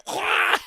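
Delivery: high mock retch; one big comic heave; imitated puking. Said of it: "And she's like".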